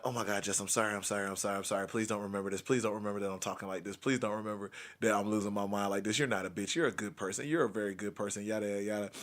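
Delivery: low, soft, rapid-fire pleading; inner apology. Retold or imitated. Secretly apologizing to her amid the angry act, hushed and pleading. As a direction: murmur a string of apologies under the breath. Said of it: "in the back of my mind".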